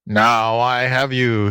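slow, drawn-out mild fox howl. A narrator's reading. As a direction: draw it out as a gloating fox. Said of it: "he howled".

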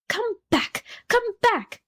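high-pitched scream without real volume; mock scream. A narrator's reading. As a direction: call after someone in a thin, high mock scream. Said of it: "screamed the rocket".

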